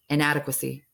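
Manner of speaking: usual speaking voice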